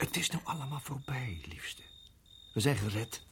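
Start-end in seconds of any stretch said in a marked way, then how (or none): 0.0-1.8 whispered reassurance; soft comfort. Acted. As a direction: soothe her gently. Man troost zijn vrouw. (zacht geruststellend)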